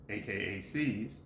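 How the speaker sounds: low, soft gloss; parenthetical aside. Real, not acted. Reader giving another name, low quiet aside. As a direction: drop well down in pitch and volume for the bracketed term.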